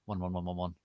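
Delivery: usual speaking voice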